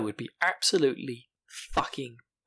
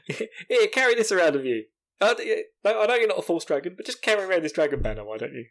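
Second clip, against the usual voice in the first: higher, louder sarcastic imitation; mock breezy instruction; 6 semitones higher, 4 dB louder than usual. Host mocking Moiraine handing Rand the banner, sarcastic. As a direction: toss it out in mocking high voice.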